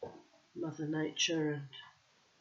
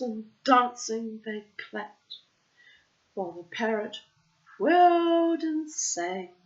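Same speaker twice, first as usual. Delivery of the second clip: storyteller describing the dance; lively narration; 6 semitones higher, 9 dB louder than usual. A narrator's reading. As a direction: read it with festive energy.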